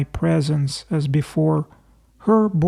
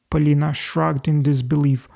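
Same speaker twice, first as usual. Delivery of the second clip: usual speaking voice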